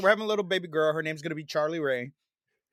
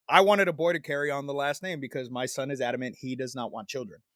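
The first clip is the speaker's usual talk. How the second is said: ordinary delivery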